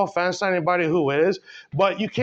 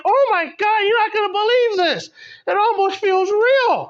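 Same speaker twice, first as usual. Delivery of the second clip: much higher, louder gushing voice; amazed woman inspector; 13 semitones higher, 4 dB louder than usual. Retold or imitated. Woman inspecting the sex doll, high loud amazed gush. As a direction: squeal it like she can't believe it.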